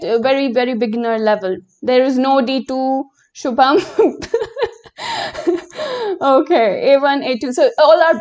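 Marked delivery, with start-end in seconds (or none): 3.8-4.7 short high-pitched laugh at her own joke; amused little laugh; high giggle. Real, not acted. Joke about D two.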